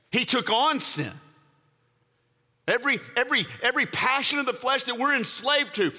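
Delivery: high, urgent preaching push; fervent preaching. Acted. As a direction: drive it home to the congregation. Preaching at full force.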